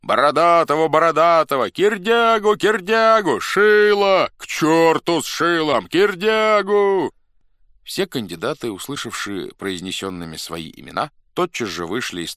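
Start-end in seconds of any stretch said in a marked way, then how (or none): 0.0-7.1 very high, very loud rival cries; crowd yelling. A narrator's reading. Rival factions roar their candidates' names, yelling. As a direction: yell the names over each other. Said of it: "кричала толпа".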